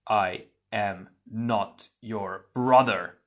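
slow, word-by-word refusal; deliberate, forceful rejection; emphatic denial. Acted. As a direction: space out each word firmly. Hero rejects his shadow twin.

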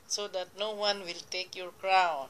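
usual speaking voice

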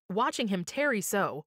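plain reading voice

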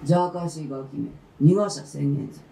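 低く速く凄む鬼婆; 小僧を脅しつける鬼婆; 鬼ババの怒声. A narrator's reading. 鬼ババが怒鳴る. (低く凄む鬼婆の声)

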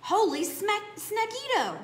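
a loud, high burst of delight; excited exclaim. Acted. Kitty boy excited at the fly he ate, high exclaim. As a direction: yelp at the tasty snack. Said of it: "exclaimed the kitty boy".

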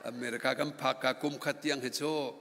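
normal talking voice

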